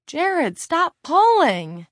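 high, drawn-out cry of protest; shouting protest. Acted. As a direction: yell it at him to stop, stretching it out. Mary protests being pulled along.

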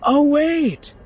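hammed-up fake surprise; mock surprise. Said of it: "in mock surprise".

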